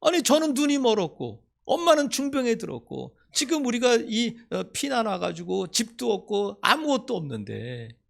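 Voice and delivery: high, loud indignant protest; boy shouting at his sick mother; angry child. Retold or imitated. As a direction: rage at the unfairness. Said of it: "이렇게 막 화를 냈다는 거야".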